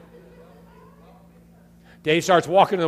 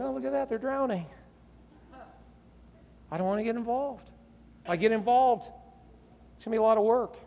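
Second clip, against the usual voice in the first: lightly voiced, higher-pitched excuse-maker; a hint of a whiny onlooker; reluctant bystander; 5 semitones higher than usual. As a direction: make small, higher excuses with pauses between. Bystanders' excuses for not helping.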